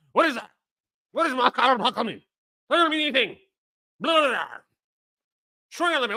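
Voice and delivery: high-pitched mock exasperated arguer; mocking imitation. Streamer mimicking a physics argument to chat, mocking. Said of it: "how do people actually talk like this".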